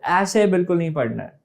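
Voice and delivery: ordinary talk, nothing marked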